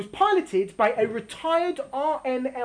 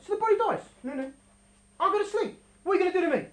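normal talking voice

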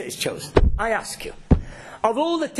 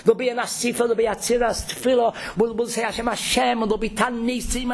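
ordinary talk, nothing marked

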